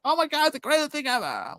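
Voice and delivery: high, gushing mock fan praise; mock a starstruck superfan; gushing Twitter fan. Twitter weirdos hyping the show, high mocking gush.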